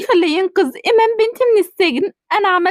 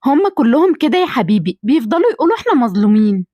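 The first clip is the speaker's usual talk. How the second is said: much lower, gentle motherly voice; lower-pitched mother greeting her son; older mother voice; 9 semitones lower than usual. Acted. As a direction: speak as the doting mother.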